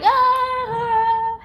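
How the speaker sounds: high, loud fake wail; a hammed-up pretend sob; mock crying wail. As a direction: put on a sung-out fake cry. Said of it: "I'm crying like".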